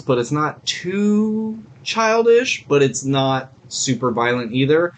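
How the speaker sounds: normal talking voice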